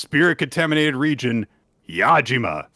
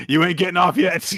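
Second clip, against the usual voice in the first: laughing; 6 semitones higher than usual. Acted. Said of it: "we're not done with this boy yet".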